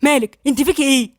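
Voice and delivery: heated, demanding questions; moderately agitated confrontation. A narrator's reading. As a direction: press her for an answer, worked up. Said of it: "عمر كمل كلامه".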